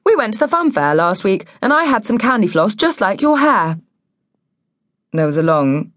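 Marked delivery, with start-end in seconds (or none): 0.0-3.8 put-on higher, chatty little-girl voice; blurting child. A narrator's reading. Girl saying the first thing in her head, innocent and high.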